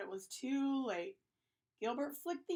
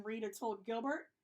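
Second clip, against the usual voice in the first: ordinary delivery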